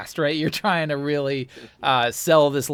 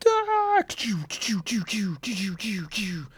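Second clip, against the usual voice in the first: mock blaster fire; 8 semitones higher than usual.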